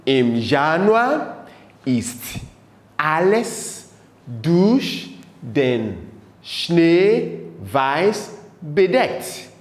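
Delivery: very slow, word by word with pauses; feed the line to learners in small pieces; slow German model with gaps for repeating; repeat-after-me dictation. Shown as a demonstration. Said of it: "Say it after me".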